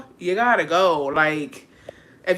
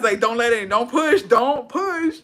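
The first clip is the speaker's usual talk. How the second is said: urgent plea; 5 semitones higher, slower than usual. Retold or imitated.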